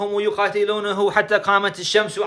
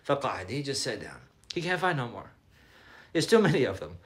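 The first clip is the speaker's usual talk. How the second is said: much softer, low, spent retelling; worn out; 6 semitones lower, 11 dB quieter than usual. Retold or imitated. Umar giving up after the long fight, acted weariness. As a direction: drop low and soft, acting exhausted. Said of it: "becomes so tired".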